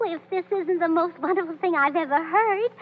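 ordinary delivery

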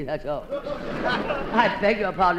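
ordinary talk, nothing marked

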